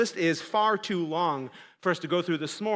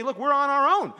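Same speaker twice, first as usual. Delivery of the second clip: raised, mocking imitation of a deist; voicing a skeptic with a raised voice; 4 semitones higher, 3 dB louder than usual. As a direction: lay out the deist's shrug loudly. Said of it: "They'd say".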